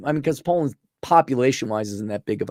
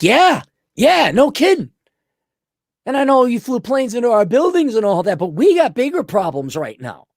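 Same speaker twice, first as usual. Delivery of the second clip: mock agreeable immigrant; 9 semitones higher than usual. Immigrants agreeing, acted quote.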